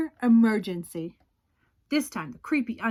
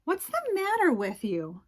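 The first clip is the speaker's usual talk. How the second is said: mom asking; 6 semitones higher than usual. Acted. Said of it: "his mom asked".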